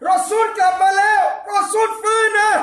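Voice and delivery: high, loud cry of hope; excited crowd shout. Retold or imitated. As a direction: shout it as the crowd believing he's back. Crowd crying Prophet is back. (ตะโกนด้วยความหวังของชาวเมือง)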